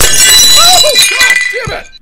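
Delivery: very loud, high jump-scare shriek; startled scream. Real, not acted. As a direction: scream as the noise hits you.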